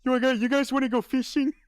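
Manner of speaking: high, fast goofy voice; mock him like a clueless kid; mocking dopey voice. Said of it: "That's how he talks".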